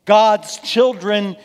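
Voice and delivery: loud, high, emphatic sermon delivery; forceful preaching. Real, not acted.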